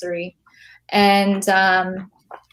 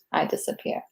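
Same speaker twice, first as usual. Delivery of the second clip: "I disappear" whispered, much softer, dying away; whispered fade; 11 dB quieter than usual. Shown as a demonstration. Poet whispers the last line so it disappears. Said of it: "the words kinda start disappearing too".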